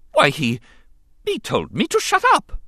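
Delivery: very high fussy droid voice; scandalised prim robot; protocol robot. A narrator's reading. Droid reports insult, scandalised.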